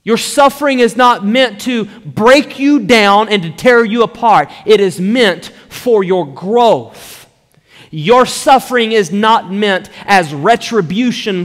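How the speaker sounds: high, loud, slow sermon delivery; impassioned preaching. Real, not acted. Pastor telling congregation why they suffer, impassioned. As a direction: preach it forcefully, stretching the key words.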